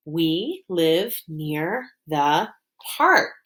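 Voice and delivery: "We, live, near, the, park" slowly, each word set apart. Shown as a demonstration. Teacher mapping a sentence for pupils, "We live near the park" word by word. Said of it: "let's map it".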